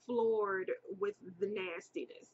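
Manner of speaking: usual speaking voice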